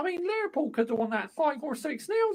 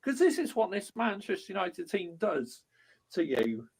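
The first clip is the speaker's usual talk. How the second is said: ordinary talk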